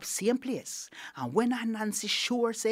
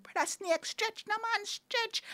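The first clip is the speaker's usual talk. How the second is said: little animals urging him, pitched way up; excited cheering; 14 semitones higher, 4 dB quieter than usual. Acted. Excited little animals egging the snake on, high-pitched.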